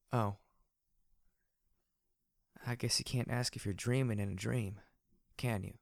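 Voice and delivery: subdued, hesitant character voice; disheartened Glory. A narrator's reading. Glory learns it is no dream, quietly disheartened. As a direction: let the hope die, with long pauses.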